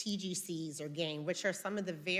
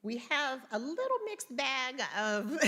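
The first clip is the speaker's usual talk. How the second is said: higher voice, tipping into a real laugh; breaking into laughter; 7 semitones higher than usual. Real, not acted. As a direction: start the phrase and give way to laughter. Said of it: "I'm laughing because I'm looking".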